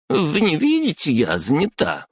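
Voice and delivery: high squealing outburst; shrieking. Acted. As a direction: shriek at him like a squeaky toy. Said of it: "взвизгнула дама".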